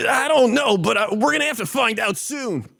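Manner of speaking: anime hero. Acted.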